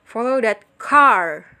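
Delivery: slow down and punch "car"; long stress on car. Shown as a demonstration. Teacher modelling focus stress for students, long loud "car". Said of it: "nada bicara yang berbeda".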